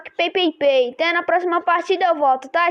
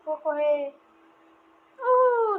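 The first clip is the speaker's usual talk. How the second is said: normal talking voice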